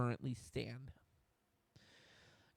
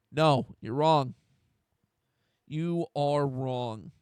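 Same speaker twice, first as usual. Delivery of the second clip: louder, slow and flat-out firm; firm rebuttal; 8 dB louder, much slower than usual. Real, not acted. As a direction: shut the critics down, word by word. Rebutting critics after mock quote.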